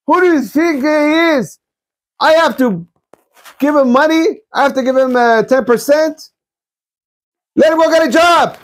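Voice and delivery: high, indignant miserly husband yelling at his wife; high-pitched scornful rant about giving nothing; stingy husband. Retold or imitated. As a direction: sneer it out like an outraged cheapskate. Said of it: "The husband says".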